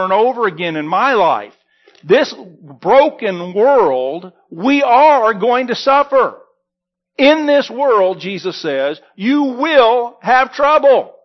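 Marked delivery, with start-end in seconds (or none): 2.0-11.1 slow, weighty, louder preacher's cadence; emphatic preaching. Acted. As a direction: lean into each phrase to land the warning. Preacher drives home the point.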